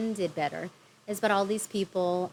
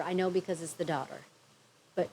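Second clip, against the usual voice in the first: ordinary talk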